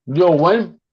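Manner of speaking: chewing while talking; mouth full. Real, not acted. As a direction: talk through a mouthful of food. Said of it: "My bad, I'm chewing".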